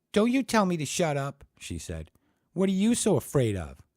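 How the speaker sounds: high, loud comeback; indignant retort. Acted. Ann firing back at Ted, high and loud. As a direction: throw it back at him.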